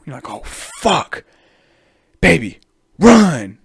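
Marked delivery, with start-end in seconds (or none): 0.3-1.2 scared whisper. Retold or imitated. Victim hears pigeons approach. 2.2-3.6 loud high yell to flee; panicked yell. Retold or imitated. Tourist yelling at girlfriend to run from pigeons, panicked shout.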